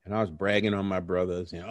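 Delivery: ordinary talk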